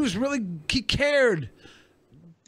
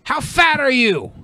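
higher, drawn-out mock stranger's question; imitated grocery stranger; 5 semitones higher, 4 dB louder than usual. Grocery stranger accosting a big customer, imitated blunt question. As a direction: blurt it out slowly as the imitated stranger. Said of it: "He goes".

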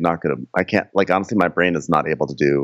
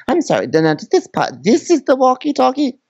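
high, clueless ditzy voice. Retold or imitated. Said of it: "she's probably asked".

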